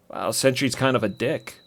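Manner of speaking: usual speaking voice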